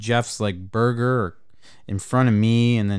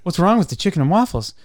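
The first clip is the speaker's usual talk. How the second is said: higher, incredulous retake of the question; mock indignant repeat; 6 semitones higher, 4 dB louder, faster than usual. Retelling lunch, asking friends why not chicken, baffled. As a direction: act baffled, push it up.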